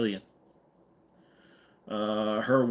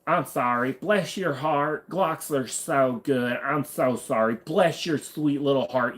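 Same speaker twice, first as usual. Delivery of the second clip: mock Southern sympathy; 3 semitones higher than usual. Mock southern apology to Glock owners.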